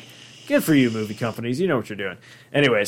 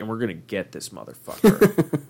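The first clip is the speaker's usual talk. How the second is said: grudge-holding dad; 4 semitones lower, 3 dB quieter than usual. Retold or imitated. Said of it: "My dad's holding on to this grudge".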